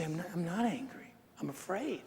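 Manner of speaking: hushed, retold fatherly denial; soft reassurance. Father answering children who think he is angry, soft reassurance. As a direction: drop right down and reassure quietly.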